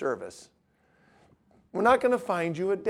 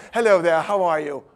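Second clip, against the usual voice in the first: prim greeting. Retold or imitated. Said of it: "I can see Justin now".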